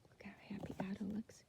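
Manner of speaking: soft whispered aside. Acted. Reader whispers an aside about the happy fish. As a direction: hush it to the listener.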